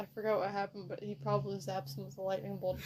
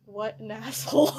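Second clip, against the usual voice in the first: laughing mid line; 13 semitones higher, 13 dB louder than usual. Real, not acted. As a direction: crack up on the insult. Laughing during Zeus quote.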